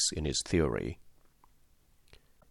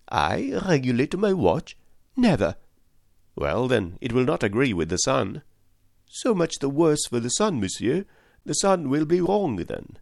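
proud indignant valet; 7 semitones higher than usual. A narrator's reading. Valet refusing to change his watch, defiant higher voice. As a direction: defend the family watch with pride.

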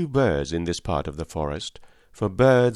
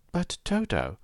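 put-on high, worried little-girl voice; anxious girl; 10 semitones higher, 3 dB quieter than usual. A narrator's reading. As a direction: pitch it up with a child's worry. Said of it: "said the girl anxiously".

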